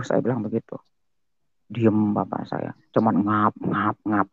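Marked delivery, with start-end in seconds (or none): 3.2-4.3 low, soft, slow puffs; imitated panting. Son describing his exhausted father, imitated panting. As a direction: mimic someone spent and gasping for air. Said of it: "napasnya kan".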